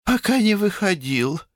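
very high-pitched motherly voice; fretful mother. A narrator's reading. Mother reporting on her younger son, high fretful voice. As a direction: answer in a high, plaintive register. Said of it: "ответила госпожа Рупамера".

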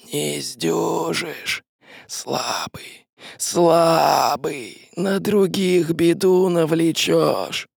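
slow, drawn-out sinister hiss; hissing shadow. Acted. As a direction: stretch each word like a ghostly threat. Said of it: "Прошипела тень".

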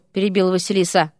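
ordinary delivery, nothing marked

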